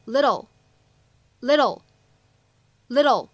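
no puff of air on the t of "little"; American flap T. Shown as a demonstration. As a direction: slur the t in "little" into a quick tap. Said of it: "存在一个特别典型的闪音".

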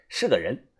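plain reading voice

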